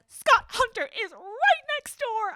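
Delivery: high, loud, drawn-out imitation; hammed-up high yell of alarm; mock outraged shout. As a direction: shout it slowly, every word hammered. Acting out an alarmed character's line.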